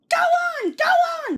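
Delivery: squeaky silly chant; naughty trucks teasing Thomas; giggling trucks. A narrator's reading. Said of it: "they giggled in their silly way".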